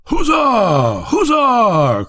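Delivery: high drawn-out cheer, falling; cheering cry. A narrator's reading. Court cheers the rocket display, high jubilant cry. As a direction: court crying huzzah in delight. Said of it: "cried the court".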